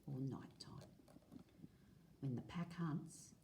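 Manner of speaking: clear, lively read-aloud for a class; reading to children. Acted. Teacher reading a dingo book to the class, lively read-aloud. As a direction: read the page out to the kids, a touch brighter.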